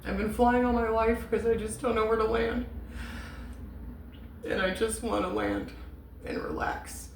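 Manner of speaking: choked up, high then fading to a whisper; getting emotional. Real, not acted. Reader retelling what he told her last night, choked with emotion. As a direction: fight back real tears until the voice gives out. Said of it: "I don't know why I'm getting emotional".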